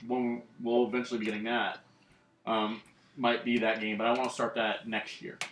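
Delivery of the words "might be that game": ordinary talk, nothing marked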